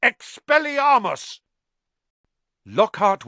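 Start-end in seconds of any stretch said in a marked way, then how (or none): 0.0-1.4 high, loud, slow bellowed spell. Acted. Harry disarms Lockhart, bellowing the spell. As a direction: blast the spell out. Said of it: "Harry bellowed".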